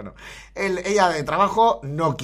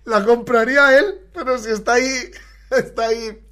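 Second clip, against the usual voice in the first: exasperated loud; 7 semitones higher, 6 dB louder than usual. Real, not acted. Exasperated outburst.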